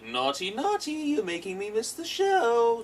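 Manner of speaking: high, slow, sing-song scolding villain; Joker impression. Joker scolds the kid for ruining the show, in a high, slow, sing-song voice. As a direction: drag it out like a teasing madman. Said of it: "He's like".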